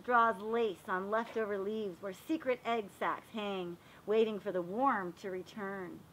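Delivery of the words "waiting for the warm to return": ordinary delivery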